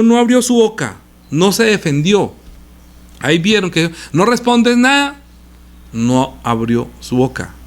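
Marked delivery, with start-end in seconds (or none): 0.0-1.0 "no abrió su boca" said higher and louder, proclaimed; raised reading. Shown as a demonstration. Preacher reading the verse aloud to his congregation, raised and emphatic. Repeats verse, contrasting delivery. 5.9-7.5 "no abrió su boca" said slow and lower, each word drawn out; slow hushed repeat. Shown as a demonstration. Preacher lingering over Jesus' silence for the congregation, slow and low. Repeats verse, contrasting delivery.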